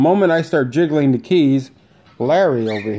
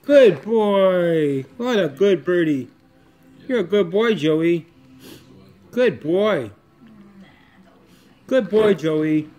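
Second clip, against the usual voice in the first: cooing pet praise; 3 semitones higher than usual. Real, not acted. Owner praising parrot Joey as it goes back in the cage, high cooing.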